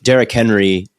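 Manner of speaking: usual speaking voice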